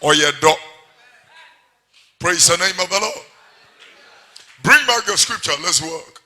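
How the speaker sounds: loud, driving sermon delivery; full-force preaching. Acted. Preacher hammering scripture at the congregation, loud.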